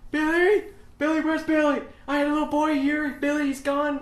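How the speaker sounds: very high, loud frantic calling; frantic lost parent. Retold or imitated. Fake guest searching for missing Billy, frantic high calls. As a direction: call out desperately for the lost boy. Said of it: "pretending to be guests, going".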